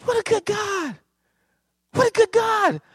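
way up high, praising; high exclaim. Real, not acted. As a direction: lift it up in praise, high and ringing. Preacher praising God.

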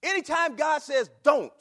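"Don't" shouted high and loud; preaching shout. Acted. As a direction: bellow the word Don't. Preaching at full force.

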